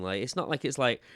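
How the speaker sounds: usual speaking voice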